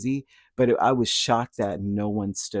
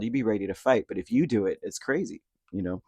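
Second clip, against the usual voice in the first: ordinary talk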